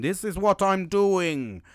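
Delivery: high, loud, slowly drawn-out declaration; emphatic announcement. Retold or imitated. Worker telling all of his region his plan, emphatic announcement. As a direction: proclaim it slowly to everyone.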